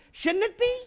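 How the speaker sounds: high and soft, rising on be; soft high question. Real, not acted. Repeated rhetorical question.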